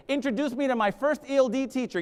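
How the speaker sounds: ordinary talk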